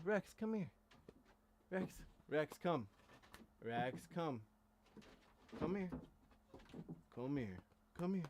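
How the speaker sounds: high calls to the puppy; calling the dog. Real, not acted. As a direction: call the puppy over, high pitched.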